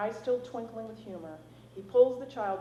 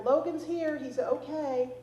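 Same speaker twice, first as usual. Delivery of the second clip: louder, higher call into the woods; calling back; 7 semitones higher, 6 dB louder, slower than usual. A narrator's reading. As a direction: call back across the distance. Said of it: "she calls back".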